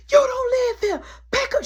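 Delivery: high, loud, crying order to leave; angry eviction. Retold or imitated. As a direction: shout her out of the house. Mary throws sister out.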